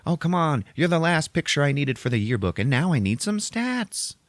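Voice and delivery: put-on high, wheedling girl voice; pestering schoolgirl. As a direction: push him for an answer, perkily. Yearbook girl pestering him.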